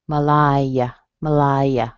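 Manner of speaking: Malaya as muh-LIE-uh, schwa first; strong middle syllable. Shown as a demonstration. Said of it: "strong in the middle".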